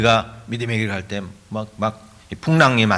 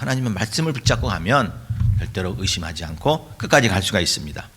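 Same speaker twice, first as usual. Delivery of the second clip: normal talking voice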